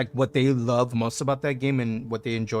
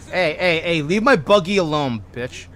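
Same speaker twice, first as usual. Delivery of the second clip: loud, higher shout at a player near the buggy; excited yell; 4 semitones higher, 5 dB louder than usual. Real, not acted. Streamer yelling at a player near his buggy, loud and excited. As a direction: yell them away from the buggy. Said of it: "I got excited".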